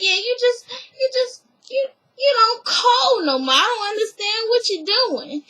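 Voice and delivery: high, mock-weepy whining; whiny drama queen. Mocking the aunts ganging up on Candy, high weepy whine. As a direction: whine it like a wounded aunt. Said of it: "they some drama queens".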